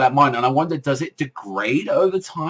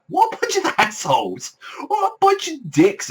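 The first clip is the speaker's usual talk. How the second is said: high-pitched mock outrage; acted indignation. Viewer scolding students who dumped someone in a tree, indignant. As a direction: tut at them in a high, scandalised voice.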